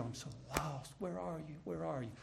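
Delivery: hushed, helpless calling; small lost voice. Acted. Preacher voicing a listener who feels lost, hushed. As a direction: call very softly, as if lost.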